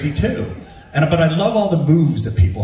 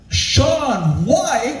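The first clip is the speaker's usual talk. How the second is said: surprised recognition; 12 semitones higher than usual. Retold or imitated. Spotting a celebrity.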